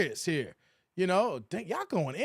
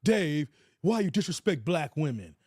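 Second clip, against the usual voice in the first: lightly voiced accusing commenter, pitched lower; mock commenter complaint; 4 semitones lower than usual. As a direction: imitate a whining critic, voice dropped. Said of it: "that come into my comment section".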